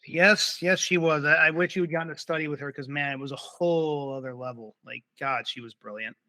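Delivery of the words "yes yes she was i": ordinary delivery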